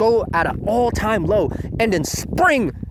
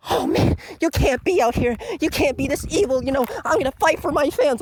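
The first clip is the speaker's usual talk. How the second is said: higher, hammed-up heroic girl; mock brave idol; 10 semitones higher than usual. Mocking Reina facing the aliens, hammed-up high voice. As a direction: play a naive idol standing firm. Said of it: "she tries to stand up to them like".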